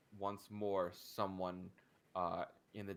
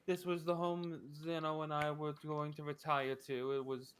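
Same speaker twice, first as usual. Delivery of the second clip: higher, louder widow, mock grieving; tearful widow; 8 semitones higher, 6 dB louder than usual. Widow laments her husband's house, higher-pitched and mock tearful. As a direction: ham up a weepy widow's lament.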